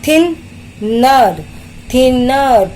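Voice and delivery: Thinner broken into थिन and नर; two separate beats in thinner; syllable-by-syllable. Shown as a demonstration. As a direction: sound out थिन, pause, then नर. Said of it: "दो syllables का आप देखोगे".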